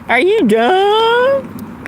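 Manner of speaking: high, long-drawn imitation of a snarky commenter; mock snarky. Self-conscious streamer imagines a viewer picking on her accent, mock snarky. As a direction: draw it out high, mocking someone who sneers. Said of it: "somebody's gonna have something snarky to say".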